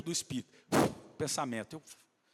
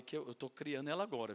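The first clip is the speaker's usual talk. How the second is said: usual speaking voice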